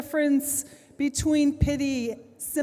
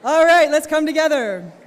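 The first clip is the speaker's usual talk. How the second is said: loud, raised call to the room; rallying the room to attention; 4 semitones higher, 10 dB louder than usual. Real, not acted. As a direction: gather a chattering audience, higher and louder. Calling a talking room to order.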